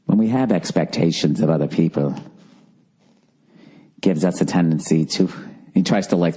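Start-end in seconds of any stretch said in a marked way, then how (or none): 0.0-5.3 "expectations of other people" in a much lower, soothing British meditation voice; soothing British accent. Retold or imitated. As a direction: mimic the calm app narrator saying "expectations of other people". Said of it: "soothing voice like this, some sort of British accent".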